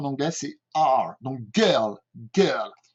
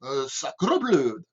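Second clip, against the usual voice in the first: put-on Béarnais accent with heavily rolled Rs; proud old Béarnais, drawling out the trilled Rs. Teacher mimicking southern French locals, rolled-R Béarnais accent. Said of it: "peuvent rouler les R".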